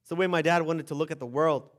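ordinary talk

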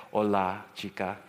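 low, halting beginner Spanish; broken Spanish. Retold or imitated. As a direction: greet in clumsy American-accented Spanish. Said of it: "in my broken Spanish".

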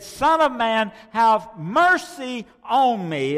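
high, loud and slow cry for mercy; desperate plea. Acted. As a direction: beg the passing Jesus as a blind man. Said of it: "He said".

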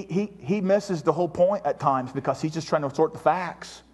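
ordinary talk

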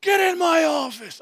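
high, drawn-out furious yell; angry boss shout. Acted. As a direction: bellow it like a boss summoning staff. Boss yelling staff into office.

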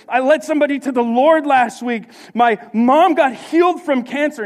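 loud, high, triumphant run of testimony; elated boasting. Acted. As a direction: rattle off victories at full voice. Acting out elated believer before setback.

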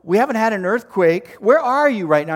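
pranking friend, mock operator raising voice; insistent operator. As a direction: talk him down firmly as a fake operator.

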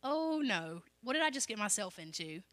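soft, hushed mock panic; a quiet "what have I done"; imitating fearful Peter. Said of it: "I can see him saying".

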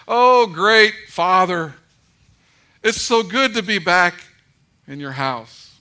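slow, loud, pompous royal greeting; mock formal address. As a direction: overblown reverence, hammed up. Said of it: "I don't talk to him like that".

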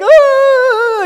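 hammed-up high loud wail of grief; theatrical paid-mourner wail; mock mourner's wail. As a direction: throw out a fake mourning cry. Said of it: "they'd be like".

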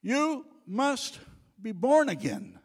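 loud preaching. Acted. Preacher booming Jesus' command to Nicodemus, loud and high. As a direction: proclaim You must be born again at full voice. Loud repeat of the same words.

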